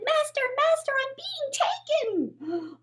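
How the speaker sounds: high wailing cry for help; crying harp. Acted. Stolen harp calling out to the giant, high and wailing. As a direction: wail to the master in high panic.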